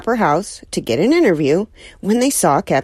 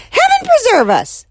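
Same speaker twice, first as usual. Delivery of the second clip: high, loud horrified cry; shocked exclamation; 5 dB louder than usual. Acted. Neighbor appalled by the penguin, crying out high. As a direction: cry out as if faced with a monster. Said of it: "said Missus Callahan".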